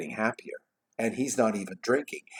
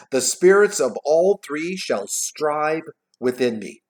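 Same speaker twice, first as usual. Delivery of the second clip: slow, slightly raised vow; solemn resolute Scrooge; 5 semitones higher, 5 dB louder, slower than usual. A narrator's reading. Scrooge vowing to change, declaiming. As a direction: declaim it slowly, word by word.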